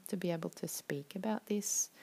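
ordinary talk, nothing marked

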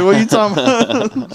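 high-pitched, incredulous retort to a sister; mock defensive comeback. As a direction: play up the baffled innocence. Retold quarrel with angry sister.

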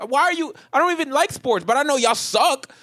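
high, loud comic outrage; exasperated retort. Retold or imitated. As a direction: snap back at a pestering sports fan. Acts out angry reply to heckler.

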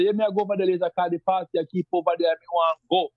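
deep rural patois. Shown as a demonstration. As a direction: rattle it off like a deep countryside Jamaican. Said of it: "Someone from deeper say".

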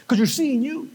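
normal talking voice

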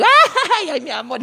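chillido agudo y meloso de enamorado; imitando pareja cariñosa. Retold or imitated. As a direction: imita a la pareja besuqueándose, exagerado. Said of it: "los más chistosos... y". (imitación meliflua de pareja)

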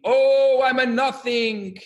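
a retold yeshiva student's mock wail; mock scream. Yeshiva student screaming across the study hall, mock wail. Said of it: "sitting on either ends of the study hall screaming".

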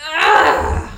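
loud breathy ugh of despair; frustrated groan. Retold or imitated. Told she'll fall a semester behind, frustrated ugh. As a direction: let out a fed-up groan. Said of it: "And I was like".

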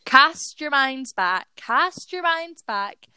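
drawn out, slow and dreamy; slow it right down like a flashback; slow dramatic. Acted. Dramatic storytelling lead-in.

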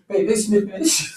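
high, exaggerated imitation of wife's alarm; imitating wife. Husband showing listeners how wife said it, high mock. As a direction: mimic her squeaky warning. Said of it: "the way you said it".

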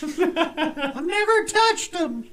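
high, loud, slow sarcastic imitation; mocking falsetto. Co-host mimicking the mom in a high mocking voice. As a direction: parody the mom's denial.

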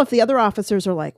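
normal talking voice

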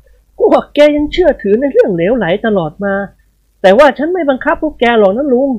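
dismissive master. Acted.

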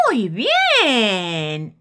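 exclaiming cricket. Acted. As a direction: cheer the idea. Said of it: "exclama el grillo".

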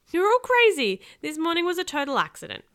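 loud, high-pitched outburst; shrieked protest. A narrator's reading. Boy to his family about the accident, high loud protest. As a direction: shriek it at them in disbelief. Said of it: "I shrieked".

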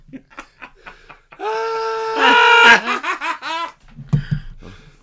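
loud, high burst of laughter; a friend laughs hard at the soapy water story, high and loud; big high laugh. Real, not acted. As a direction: crack up at the story.

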